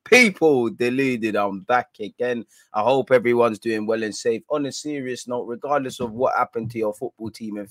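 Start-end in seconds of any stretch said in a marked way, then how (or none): 0.1-2.5 slow, each word stretched out; slow drawn-out catchphrase. Shown as a demonstration. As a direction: drag the catchphrase out slowly as a ritual opener. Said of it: "So first things first".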